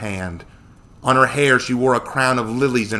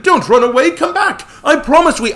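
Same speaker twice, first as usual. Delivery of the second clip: loud, very high pleading cries; desperate shouting; 9 dB louder than usual. A narrator's reading. Samuel calls after the fleeing nymphs, desperate shouting. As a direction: yell after them, pleading. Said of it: "Samuel shouted".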